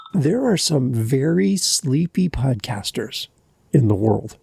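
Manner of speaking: ordinary talk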